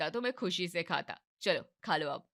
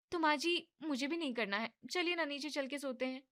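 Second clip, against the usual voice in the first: higher, softer coaxing; coaxing young wife; 8 semitones higher, 3 dB quieter than usual. Acted. Wife coaxes mother-in-law to go back to bed, higher and soft. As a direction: wheedle your way out of exercise.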